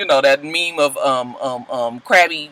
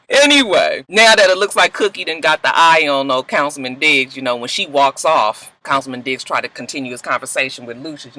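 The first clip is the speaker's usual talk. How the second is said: ordinary talk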